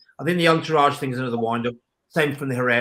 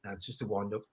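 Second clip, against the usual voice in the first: much lower and softer, deflated; retold letdown; 7 semitones lower, 13 dB quieter than usual. Fan retelling the transfer letdown, low deflated undertone. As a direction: drop right down as the excitement dies.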